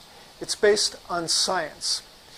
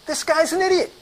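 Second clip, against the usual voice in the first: high-pitched, indignant rant; 8 semitones higher than usual. Retold or imitated. Rep phoning his sales manager, ranting about a doctor. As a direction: vent in a rush of exasperation. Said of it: "I'm just ranting".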